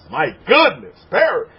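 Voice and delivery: usual speaking voice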